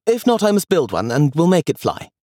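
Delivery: put-on high, hopeful little boy; boy insisting to his grandmother; child James. A narrator's reading. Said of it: "he said".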